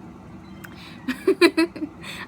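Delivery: breathy giggle burst; giggling again; laughing. Real, not acted. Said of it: "I can't stop laughing".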